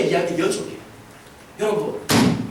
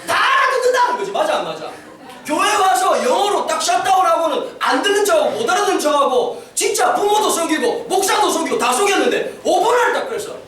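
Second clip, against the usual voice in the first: usual speaking voice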